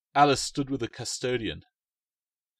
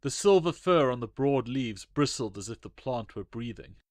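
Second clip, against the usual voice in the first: ordinary delivery, nothing marked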